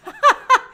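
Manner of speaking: high, loud laugh, quickly cut off; burst of laughter. Real, not acted. Host laughing at him touching Ayo, a high, loud laugh. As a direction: laugh out at how rude he is.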